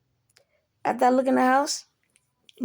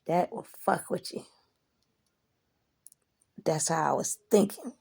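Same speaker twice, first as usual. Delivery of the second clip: ordinary talk, nothing marked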